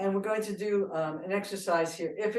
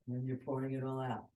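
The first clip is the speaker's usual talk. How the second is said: ordinary delivery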